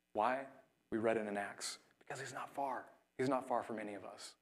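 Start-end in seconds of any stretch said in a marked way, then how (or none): none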